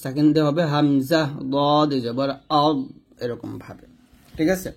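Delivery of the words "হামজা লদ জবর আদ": slow spelling-out with a heavy 'আদ'; thick dad ending. Shown as a demonstration. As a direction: close 'আদ' thick at the tongue's side. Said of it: "মোটা করে উচ্চারণ করতে হবে". ('আদ' মোটা করে ধীরে উচ্চারণ)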